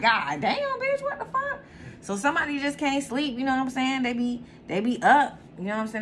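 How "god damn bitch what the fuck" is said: woken annoyed. Retold or imitated. Woken from sleep, high laughing outrage. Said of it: "you're like".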